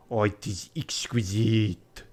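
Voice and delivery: put-on slow awed French-accented line; breathed French accent. Passepartout gazing at the Parthenon, awed French accent. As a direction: marvel at the ruins in French accent. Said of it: "I breathed".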